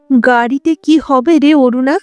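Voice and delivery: ordinary delivery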